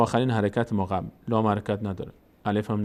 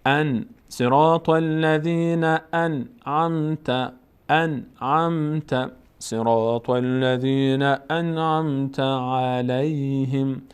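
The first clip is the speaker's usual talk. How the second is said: very slow, sustained tajweed chant; Quran recitation; 3 semitones higher, much slower than usual. Shown as a demonstration. Teacher breaks the verse into pieces, slow recitation. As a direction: chant each piece slowly for learners to follow.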